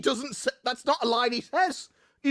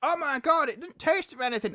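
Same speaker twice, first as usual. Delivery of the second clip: higher-voiced mock exclamation; mock American complainer; 5 semitones higher than usual. Arguing about British food, mock American complainer. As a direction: imitate a complaining tourist, pitched up. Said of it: "Americans are all like".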